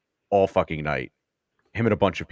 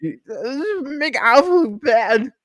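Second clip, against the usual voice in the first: put-on high, strained, slurred comic drunk; squeaky, wobbly drunk cadence caller; goofy drunk voice; 4 dB louder, much slower than usual. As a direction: play the wasted guy calling cadence like a zany cartoon. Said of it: "Sounds like Police Academy".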